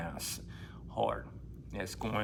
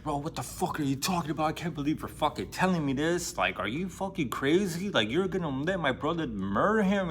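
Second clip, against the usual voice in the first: higher, incredulous angry protest; outraged friend; 5 semitones higher than usual. Retold or imitated. Ivan yelling at Smerdyakov in a mock outraged voice. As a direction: push back in disbelief at the news. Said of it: "He's like".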